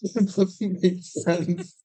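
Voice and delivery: high, disbelieving outburst; incredulous. Real, not acted. Reacting to absurd scene.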